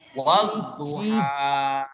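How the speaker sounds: "wadduha" slow, with the d doubled and no l; tajwid reading. Shown as a demonstration. Teacher models the assimilated reading for pupils, slowly. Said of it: "alif lam ketemu dengan dot".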